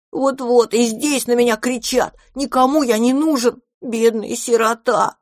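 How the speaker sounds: high, whining little dragon; hammed-up tearful complaint, pitched high; wailing self-pity. A narrator's reading. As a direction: lament like a poor orphan, drawing out the end. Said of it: "дракончик разрыдался по-настоящему".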